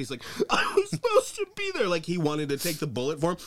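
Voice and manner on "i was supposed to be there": put-on shrill childish whine at the press conference; whiny high Trump-ish voice. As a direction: play him as a wailing kid wanting credit. Mocking whiny Trump again.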